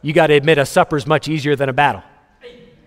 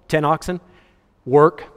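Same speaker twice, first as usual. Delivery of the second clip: ordinary talk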